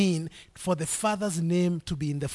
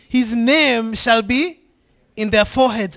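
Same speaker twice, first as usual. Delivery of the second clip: half-speed, louder Bible reading; slow scripture proclamation; 5 dB louder than usual. Acted. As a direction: lay out each word slowly for the church. Reads verse, slow and raised.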